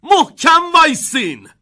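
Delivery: high, loud drawn-out warning yell; shouted command. A narrator's reading. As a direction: shout it out as an urgent order. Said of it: "آقای ونکا فریاد زد".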